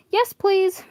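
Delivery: high, loud eager shout; near yell. Acted. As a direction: practically yell it. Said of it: "Paper practically yelled".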